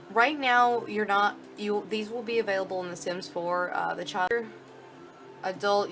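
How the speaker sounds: normal talking voice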